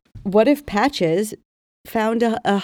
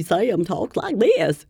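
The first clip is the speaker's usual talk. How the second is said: slow, mock West Virginia accent; put-on West Virginia accent; slower than usual. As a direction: imitate a West Virginian, drawing it out. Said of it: "Sam's from West Virginia".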